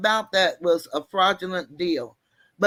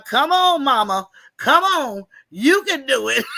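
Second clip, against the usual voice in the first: mock cheering man; 6 semitones higher, 5 dB louder than usual. Man cheering her through turnstile.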